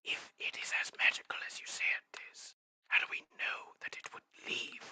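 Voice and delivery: hushed secretive whisper. Acted. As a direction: whisper it like a conspirator. Whispering in-character voice.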